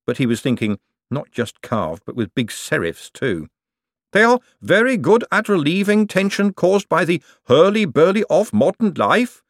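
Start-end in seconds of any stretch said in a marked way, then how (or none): 4.1-9.4 intoning golem. A narrator's reading. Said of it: "Gladys intoned".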